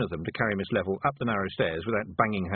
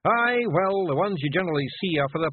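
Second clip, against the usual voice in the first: high, rough little Scottish pictie, gently; a tiny Scots warrior breaking bad news; Feegle voice; 9 semitones higher, 4 dB louder than usual. Rob replies.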